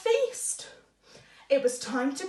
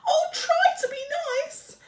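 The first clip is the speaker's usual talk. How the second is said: frightened whimper; 12 semitones higher, 4 dB louder than usual. Acted. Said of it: "he whimpered".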